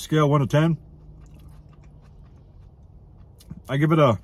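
speaking between bites; talking while chewing. Real, not acted. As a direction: mouth partly full. Seen 1: Bites burrito at -9; cheeks still working, lips pressed during words.

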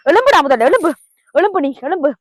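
loud angry yelling of insults; catching the cheating friend, yelling in rage; furious scolding. Acted. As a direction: bark the insult again and again.

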